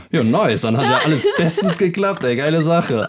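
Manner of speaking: mock-cheerful, laughing through the words; laughing sarcastic relief. Telling his buddy it went great, laughing sarcastically. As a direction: laugh it off like it all went perfectly.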